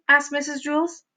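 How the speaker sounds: plain reading voice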